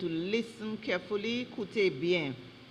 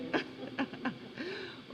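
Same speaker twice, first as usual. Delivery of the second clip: soft laugh after a slip; 4 semitones higher, 13 dB quieter than usual. Real, not acted. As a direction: laugh quietly at your own mistake. Pronouncer laughs after apologising for slip.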